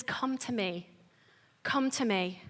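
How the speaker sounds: barely above a hush, drawing someone close; soft reassuring call; gentle invitation. Retold or imitated. Jesus cutting through harsh voices.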